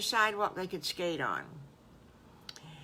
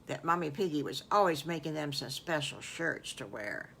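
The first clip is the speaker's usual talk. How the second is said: plain reading voice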